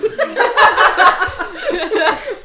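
loud, high burst of laughter; laughing hard. Real, not acted. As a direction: crack up helplessly. Cracking up at the table.

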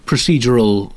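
ordinary talk

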